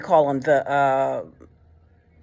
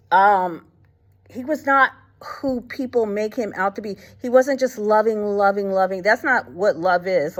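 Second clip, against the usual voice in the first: usual speaking voice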